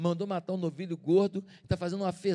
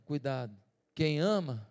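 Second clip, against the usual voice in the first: ordinary delivery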